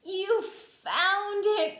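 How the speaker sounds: excited cry. Acted. Toad overjoyed that Frog found spring, excited cry. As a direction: cry it out in delight. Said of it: "cried Toad".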